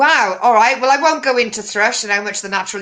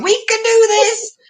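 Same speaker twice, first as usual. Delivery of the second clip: mock rallying cry; 10 semitones higher, 4 dB louder than usual.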